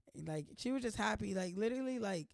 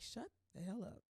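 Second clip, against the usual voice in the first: muttered viewer voice; 11 dB quieter than usual. Pretend. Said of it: "I know y'all probably like".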